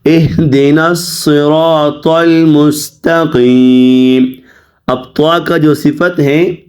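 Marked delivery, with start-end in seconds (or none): 0.0-4.2 slow, measured recitation with drawn-out vowels; Quran recitation. Shown as a demonstration. Teacher models the verse for students, slow recitation. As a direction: recite slowly and evenly, stretching long vowels.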